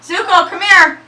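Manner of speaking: loud, high-pitched call; yelling. Real, not acted. Dog owner calls a straying dog, yelling. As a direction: yell for the dog to come. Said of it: "I'm so sorry for yelling".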